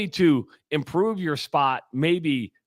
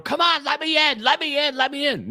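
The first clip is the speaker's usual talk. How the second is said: high, mock whining let me in; whiny pleading driver; 13 semitones higher than usual. Stuck driver mocking others begging to merge, high whine. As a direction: beg in a high nagging voice. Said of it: "They're sitting there going".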